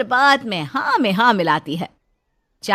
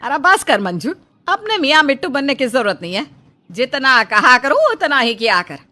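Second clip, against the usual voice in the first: गुस्से में डाँट; 3 semitones higher, 8 dB louder than usual. Acted. मालकिन नौकरानी को डाँटती.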